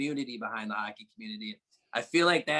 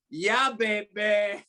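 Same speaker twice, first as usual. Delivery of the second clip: put-on slow, drawn-out Russian-accented taunt, a bit higher; imitated Russian accent; 6 semitones higher, 4 dB louder, much slower than usual. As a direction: mimic the Russian goalie throwing the taunt back, slowly. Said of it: "Russian accent".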